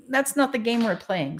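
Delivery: ordinary delivery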